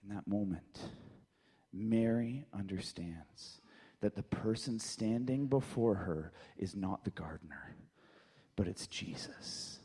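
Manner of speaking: slow, low, near-whispered narration; hushed storytelling. Acted. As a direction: drop to a hush for the moment of recognition.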